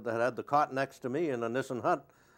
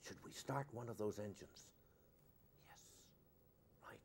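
very soft, nearly whispered retelling; hushed, cathedral reverence; 18 dB quieter than usual. Retold or imitated. Engineers deciding to start a huge engine, reverently hushed. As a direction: hush it as if inside a cathedral. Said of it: "as if you were in a cathedral".

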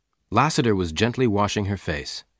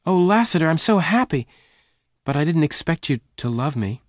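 high-pitched happy gush; overjoyed; 8 semitones higher than usual. Acted. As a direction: let relief and joy lift the voice. Jane overjoyed at declared love.

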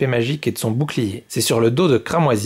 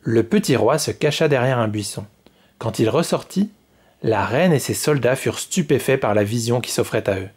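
ordinary delivery

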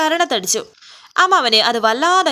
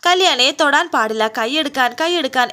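usual speaking voice

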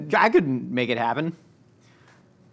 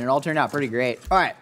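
usual speaking voice

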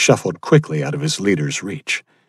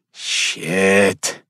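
ordinary delivery, nothing marked

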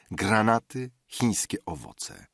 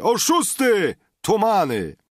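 high, loud name-calling; shouted insults; 13 semitones higher, 7 dB louder than usual. A narrator's reading. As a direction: hurl each insult across the room. Biesiadnik miota obelgi. (głośno wykrzykiwane obelgi)

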